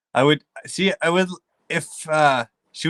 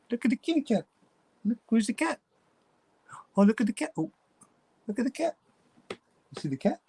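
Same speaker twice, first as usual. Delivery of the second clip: talking to a pet; 8 semitones higher, 3 dB quieter than usual. Real, not acted. Baby talk to dog about cat.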